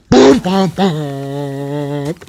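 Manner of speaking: loud booming drum hits; dramatic boom. Pretend. Commenter mimicking ominous drums, loud boom. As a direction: hit each boom like a drum.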